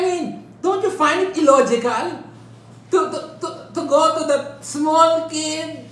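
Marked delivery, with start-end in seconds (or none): none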